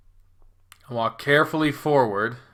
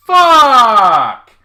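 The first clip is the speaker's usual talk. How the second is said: sudden high, very loud yell; shouted curse; 12 semitones higher, 15 dB louder than usual. Acted. Narrator knocks the lamp over, shouted curse. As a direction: burst out yelling in alarm.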